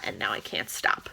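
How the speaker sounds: hushed confession, voice gone unvoiced; whispered aside. Real, not acted. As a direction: whisper it like a guilty secret. Confiding aside about reading habit.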